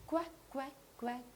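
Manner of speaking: quacking like a duck. Shown as a demonstration. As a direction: imitate a duck's quack. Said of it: "I will produce a sound".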